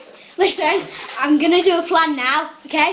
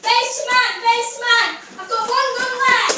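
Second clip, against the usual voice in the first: urgent calling; 5 semitones higher, 3 dB louder than usual. Real, not acted. Urgent in game.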